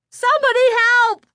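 high, loud scream for help; frantic cry. A narrator's reading. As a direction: scream in panic from the crowd. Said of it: "the frantic cries for help".

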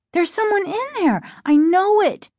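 put-on high, urgent, alarmed girl; crying out. A narrator's reading. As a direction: cry it out with frantic certainty. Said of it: "Margaret cried".

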